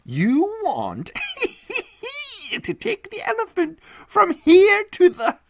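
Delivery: high voice breaking into laughter; laughing hard. Acted. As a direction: barely get the words out for laughing. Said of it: "He laughed so hard".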